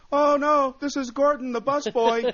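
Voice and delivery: very high, loud fake phone voice; badly disguise your voice as someone else; Gordon the busboy. Club owner dodges the comic's call, in a put-on high voice. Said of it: "he would pretend like he was other people".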